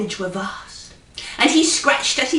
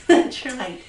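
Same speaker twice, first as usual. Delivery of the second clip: usual speaking voice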